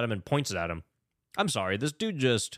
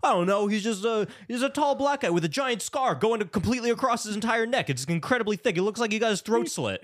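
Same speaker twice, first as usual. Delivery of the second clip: much higher mock-innocent voice; high, put-on clueless recounting; mock cluelessness; 9 semitones higher than usual. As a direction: imitate someone feigning vagueness, pitched way up. Acting out Adam's sudden detailed memory.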